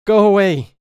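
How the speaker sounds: put-on a child's wild shriek in the dark; terrified scream. A narrator's reading. Ghost child screams at the intruder, terrified shriek. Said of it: "it screamed".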